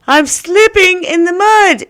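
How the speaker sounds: very high, loud squeal; hammed-up alarm, high and stretched; mock panicked cry. Shown as a demonstration. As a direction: squeal it out as if losing your footing. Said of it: "make it sound even better".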